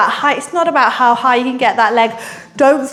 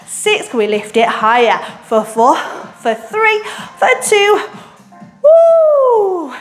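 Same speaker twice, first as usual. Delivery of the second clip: high, peppy countdown cheer; aerobics instructor; 8 semitones higher, slower than usual. Acted. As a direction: pump up the class as you count.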